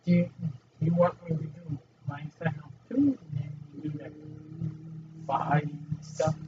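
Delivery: ordinary talk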